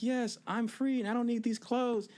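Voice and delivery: high, gleeful shout of release; the tripping guide tossing off his clothes; giddy stoned freedom. Retold or imitated. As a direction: sing out like you've just been set free. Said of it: "he was like".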